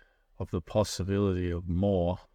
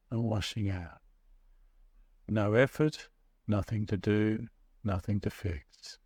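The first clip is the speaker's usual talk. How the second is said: guided meditation. Acted. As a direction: ease them into the breath, unhurried. Leads a guided breathing meditation.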